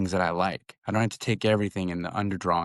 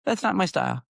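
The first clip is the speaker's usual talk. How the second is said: sassy daughter; 10 semitones higher, faster than usual. Retold or imitated. Daughter rejecting mom's drawing tips.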